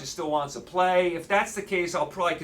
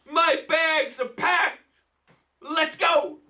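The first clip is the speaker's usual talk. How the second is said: roaring rallying speech; 4 semitones higher than usual. Acted. Sarcastic rallying call again.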